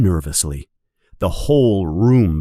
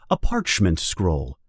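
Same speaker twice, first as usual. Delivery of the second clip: plain reading voice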